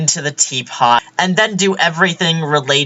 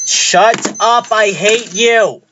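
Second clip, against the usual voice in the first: a hammed-up snap of shut up, I hate you, pitched higher; play-acting a tantrum, higher and slowed at the end; mock angry outburst; 6 semitones higher, slower than usual. Vlogger fake-yelling at an imaginary person, mock angry. Acted outburst in vlog reading.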